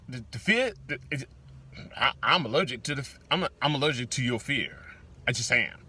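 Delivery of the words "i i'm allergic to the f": usual speaking voice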